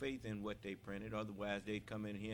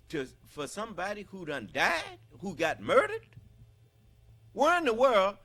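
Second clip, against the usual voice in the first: high, loud, full-force preaching cry; rising sermon shout, hammering each phrase; fiery preaching; 10 semitones higher, 11 dB louder than usual. Acted. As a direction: preach it out to the hall at the top of your voice.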